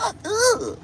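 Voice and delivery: very high squeaky disgusted cry; disgusted squeal. Retold or imitated. Seeing her long toenails, high disgusted squeal. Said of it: "I was like".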